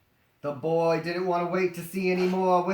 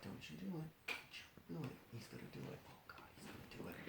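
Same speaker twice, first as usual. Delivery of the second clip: much softer hushed warning whisper; 3 semitones lower, 26 dB quieter, much faster than usual. Acted. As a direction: breathe it tensely under the breath. Gorilla's silent warning.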